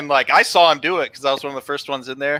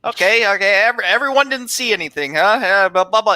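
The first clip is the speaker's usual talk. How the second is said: high mock rant; ranting teacher; 8 semitones higher than usual. Teacher going off at the class, high mock rant. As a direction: rattle it off like an irritated teacher.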